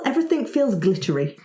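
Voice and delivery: usual speaking voice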